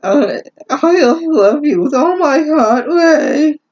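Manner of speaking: high, hammed-up weepy declaration; fake-sob it like a soap opera; mock crying confession. Reader voicing a tearful love confession, high hammed-up fake crying.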